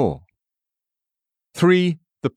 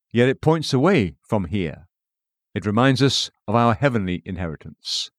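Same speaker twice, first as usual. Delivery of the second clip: ordinary talk, nothing marked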